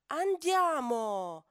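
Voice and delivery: loud, slow, drawn-out call; an at-the-end-of-her-rope mom calling; exhausted mother. Acted. Worn-out mother calling her son out of the pool. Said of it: "dice la mamma, sfinita".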